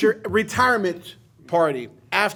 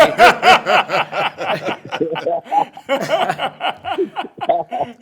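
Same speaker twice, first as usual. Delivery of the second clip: long, high, loud laughing fit; real laughter; 7 semitones higher, 6 dB louder than usual. Real, not acted. Storyteller cracking up over the hotel story, long high laughter. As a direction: laugh hard and long after the quip.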